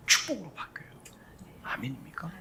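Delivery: hushed whisper of the promise. Real, not acted. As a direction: breathe the blessing out. Sermon point dropped to a hush.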